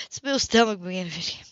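ordinary delivery, nothing marked

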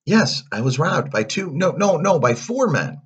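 high, loud, excited fibbing; blurted lie. A narrator's reading. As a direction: pile on the lie, correcting yourself. Pinocchio inflating his lie.

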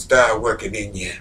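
low, soft preaching voice; hushed preaching. Real, not acted. Preacher quietly telling the congregation about forgiveness, low and soft.

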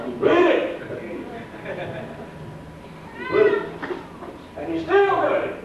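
"he did it" driven home in a high, ringing preacher's voice; emphatic repetition. Real, not acted. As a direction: pitch jumps high to hammer "he did it" and "still doing it". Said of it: "Oh, emphasize".

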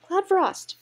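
loud, high call of a name; acted high-pitched hail. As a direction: call to someone across the way. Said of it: "Bracken Mask called her".

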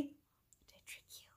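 playful whispered aside; teasing stage whisper. Real, not acted. Teasing aside to young viewers.

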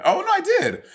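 very high-pitched, surprised self-correction; sudden realization. Real, not acted. Host suddenly remembering having seen [name] live, surprised. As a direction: leap way up as the memory hits.

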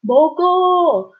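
put-on 먹어 in a high playful toddler voice; a two-year-old saying 먹어. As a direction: imitate a small child demanding food. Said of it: "two-year-old loves to say this".

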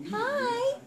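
ordinary delivery

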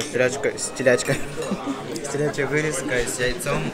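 ordinary delivery

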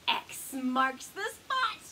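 plain reading voice

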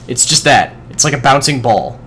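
normal talking voice

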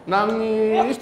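high, stretched comic whine; slow, hammed-up crying imitation; mock crying. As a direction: drag it out like pretend weeping. Preacher mimics daily crying, joking.

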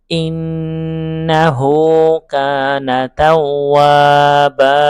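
slow, melodic tajweed chant; chanted Quranic recitation, long held notes. Shown as a demonstration. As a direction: recite slowly, drawing out each vowel.

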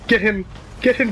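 ordinary delivery

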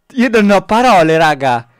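loud, high, disbelieving; amazed shouting. Real, not acted. Reacting to the impossible trick. (urlo incredulo)

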